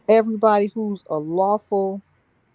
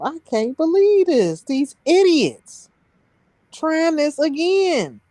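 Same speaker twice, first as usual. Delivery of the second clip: higher and slow, fed-up disbelief; incredulous, slowed, pitched-up inner voice; exasperated disbelief; 8 semitones higher, slower than usual. Retold or imitated. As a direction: ask what's wrong with them, drawn out and high. Exasperated thoughts in the courtroom.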